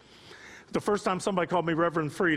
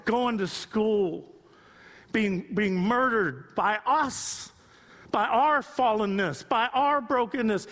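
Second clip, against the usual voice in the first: slow weighted lament; 4 semitones higher, slower than usual. Real, not acted. Slow grieving emphasis.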